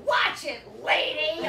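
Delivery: loud, high yell; angry driver shouting. Retold or imitated. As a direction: yell it out the car window. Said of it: "she yells out the window".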